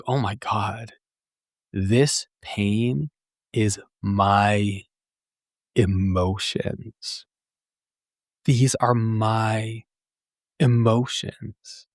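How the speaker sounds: slow, weighty, drawn-out words; sincere, slow dawning of truth; deeply felt realization. Retold or imitated. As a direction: let each word land like a revelation. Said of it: "if I'm really feeling into it".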